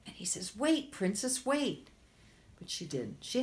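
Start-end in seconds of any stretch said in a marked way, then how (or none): 0.6-1.9 1 high calling frog. Acted. Frog calls after fleeing princess.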